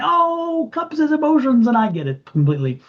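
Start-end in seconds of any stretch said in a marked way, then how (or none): 0.0-1.7 high-pitched mock objection from a know-it-all viewer; imitating a skeptical viewer. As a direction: squeak it out like a viewer correcting you. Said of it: "some of you are gonna tell me".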